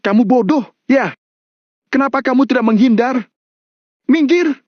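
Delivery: high-pitched furious scolding; heated outcry, climbing to a high command; angry exclamation. A narrator's reading. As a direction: berate him and order him away, pitched very high. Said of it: "dia pun langsung berseru".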